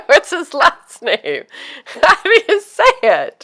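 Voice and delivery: high, squeaky words through giggles; real laughter breaking up the question; laughing helplessly. Real, not acted. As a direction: crack up while asking. Cracking up over own mispronunciation.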